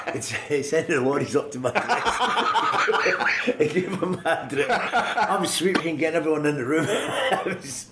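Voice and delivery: laughing through the words. Real, not acted. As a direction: laugh as you tell it. Laughing at the coach's trick.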